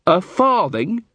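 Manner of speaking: high, rising echo of FARTHING; incredulous. Acted. Muldoon to Carrot over the house's value, incredulous. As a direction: throw FARTHING back as an outraged question.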